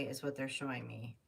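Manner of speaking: ordinary talk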